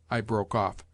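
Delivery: usual speaking voice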